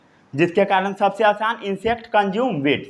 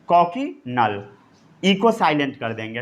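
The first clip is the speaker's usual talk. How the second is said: normal talking voice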